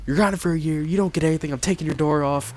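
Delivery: lightly acted strict-parent tirade; strict mother. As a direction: lay down punishments like an angry parent. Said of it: "She's pretty strict about things".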